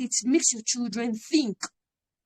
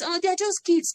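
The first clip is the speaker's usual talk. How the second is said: mock dismissive parent; 8 semitones higher than usual. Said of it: "Don't see them as".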